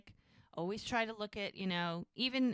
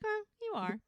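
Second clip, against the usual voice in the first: teasing concession; 12 semitones higher than usual. Retold or imitated. Retold flirting, teasing compliment.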